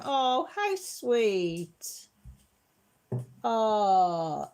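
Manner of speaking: very slow, drawn out tender aww; sweetly moved reply; touched. Real, not acted. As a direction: melt at the comment. Touched by viewer comment.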